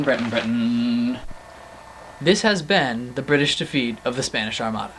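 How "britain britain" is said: "Britain, Britain" lower and slow, deflating; dejected fade. Acted. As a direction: let "Britain, Britain" sag slowly in defeat. Chant sinking in defeat.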